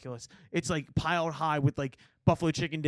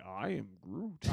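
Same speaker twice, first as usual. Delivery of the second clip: low slow Groot voice, stretched out; 9 semitones lower, 13 dB quieter, much slower than usual. Retold or imitated. Podcast host doing Groot low and slow. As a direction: drawl it like the tree creature. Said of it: "he didn't say".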